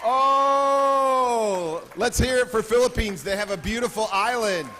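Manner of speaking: loud, high-pitched whoop into a hyped call; crowd-rallying cheer. Real, not acted. Host cheering on the Philippines guests, loud and excited.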